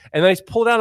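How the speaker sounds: ordinary talk, nothing marked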